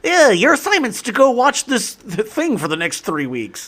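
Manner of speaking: high, bright teacher impression; hammed-up teacher assigning homework; mock teacher voice.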